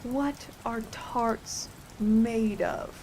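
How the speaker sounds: very slow, soft, deep-voiced question. A narrator's reading. King questioning the cook in a deep, slow voice. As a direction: draw each word out in a deep voice. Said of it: "he said in a deep voice".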